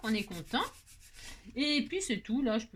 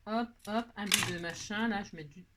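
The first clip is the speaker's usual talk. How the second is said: ordinary talk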